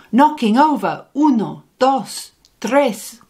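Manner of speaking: plain reading voice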